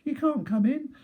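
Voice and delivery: high-pitched pig voice, yelling through the door; pig yelling back. Retold or imitated. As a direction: squeal it back like a cocky little pig. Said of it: "He yelled back".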